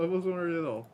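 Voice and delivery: normal talking voice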